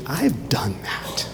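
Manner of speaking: soft whisper, slowly; whispered confession. Shown as a demonstration. Preacher to listeners, a hushed whispered confession. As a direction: breathe it like a private admission. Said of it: "we should think".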